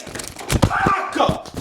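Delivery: strangled high scream of fuck; shouted curse. Real, not acted. Student venting at the camera, screaming in frustration. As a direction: let out a raw scream. Ranting about pen-and-paper memo.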